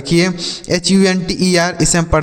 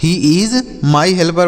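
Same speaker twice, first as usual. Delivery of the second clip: slow demo; much slower than usual. Shown as a demonstration. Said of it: "वह मेरी मदद करने वाला है".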